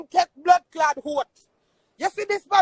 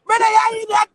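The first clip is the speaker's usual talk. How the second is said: loud, angry shout; shouting that he is vexed, not crying; angry denial; 3 semitones higher, 4 dB louder than usual. Real, not acted. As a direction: shout him down in anger.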